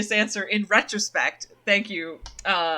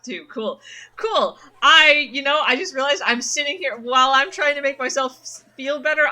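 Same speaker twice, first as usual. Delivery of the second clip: ordinary talk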